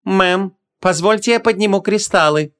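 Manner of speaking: high, level, stiff servant voice; prim, stiff. Acted. Servant offering to raise crystals, prim. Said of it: "чопорно предложил Хуберт".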